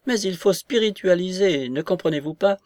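high, agitated grande dame protesting; retold outburst of an exasperated lady; exasperated cry. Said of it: "je lui ai crié".

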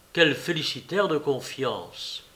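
ordinary delivery, nothing marked

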